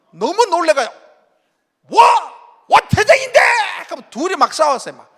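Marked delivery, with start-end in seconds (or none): 1.9-3.4 loud, high protesting yell; outraged shout. Retold or imitated. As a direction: argue back in disbelief. Arguing back at the umpire.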